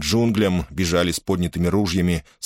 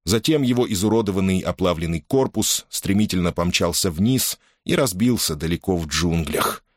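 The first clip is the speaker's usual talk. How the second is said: ordinary delivery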